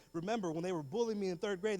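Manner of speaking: normal talking voice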